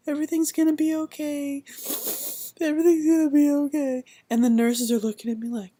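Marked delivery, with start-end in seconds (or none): 0.1-4.0 high, louder, choked-up comfort; tearful reassurance. Retold or imitated. Crying mother tells herself everything will be okay. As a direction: tell yourself it'll be fine through tears.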